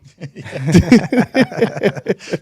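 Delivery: loud, high burst of real laughter; big burst of laughter. Real, not acted. Asked if he still misses her.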